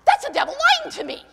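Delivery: high, loud insistence; acted convert's declaration. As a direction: declare it like a newly freed believer. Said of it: "you go".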